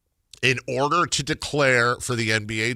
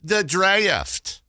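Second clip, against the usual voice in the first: Southern "draft" stretched into two syllables, pitched high; 8 semitones higher, 4 dB louder than usual. Shown as a demonstration. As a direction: say "draft" as "dray-uft", Southern style. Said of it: "draft has to be two syllables".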